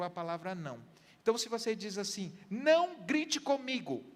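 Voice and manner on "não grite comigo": loud, slow, high-pitched "don't shout at me"; mock raised plea. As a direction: act out the pleading partner, pushed up and slowed. Quoting a partner's plea.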